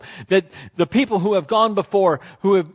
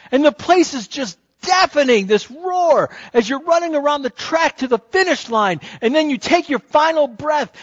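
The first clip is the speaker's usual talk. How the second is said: loud, high, driving sermon climax; preacher in full flight, carried away; preaching at full force; 7 semitones higher, 4 dB louder than usual. Acted. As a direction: build the scene, louder and higher as the runner nears. Stadium climax continues.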